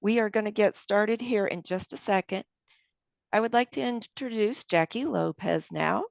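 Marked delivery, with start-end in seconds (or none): none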